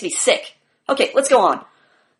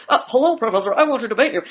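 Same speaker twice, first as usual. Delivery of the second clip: softer, mocking nerdy student; pretentious nerd voice; 3 semitones lower, 4 dB quieter, faster than usual. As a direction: play a timid pretentious nerd. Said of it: "a pretentious Harvard graduate".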